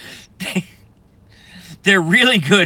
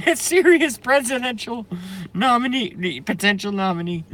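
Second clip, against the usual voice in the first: incredulous; 7 semitones higher than usual. Real, not acted. Disbelief at candidate.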